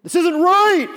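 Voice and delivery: high, loud shout to the Father; outraged shout. Retold or imitated. Preacher imagines himself crying out to God, high loud shout. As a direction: cry out in protest, full voice. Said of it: "I'm like".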